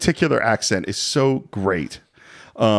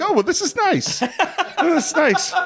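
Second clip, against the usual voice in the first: put-on much higher, giggly fussy old gent; delighted Brody. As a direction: squeal it out, tickled and oblivious. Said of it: "Brody's like".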